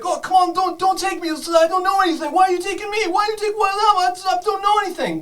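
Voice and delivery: high, loud, panicked pleading; shrill panicked captive pleading with the kobolds; frightened protest. Acted. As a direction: beg them not to take you, terrified. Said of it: "she's screaming and protesting".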